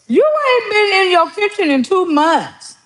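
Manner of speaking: high mocking sister voice; sister's voice. A high teasing sister voice in a retold call.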